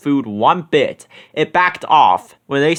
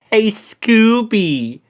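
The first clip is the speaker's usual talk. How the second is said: higher, slow calling out; Shaggy calling; 7 semitones higher, much slower than usual. A narrator's reading. Shaggy calling to Scooby in the trees, higher slow call. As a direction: call across the treetops. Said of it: "Shaggy called".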